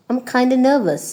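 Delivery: "kinda" with a schwa ending, no f; shortened form. Shown as a demonstration. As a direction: blend kind of into "kinda". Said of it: "you can say".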